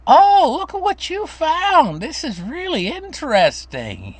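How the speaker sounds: put-on high, slow, marveling old lady; sweet old grandma. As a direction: wonder at the child's find, up high. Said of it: "she'd say".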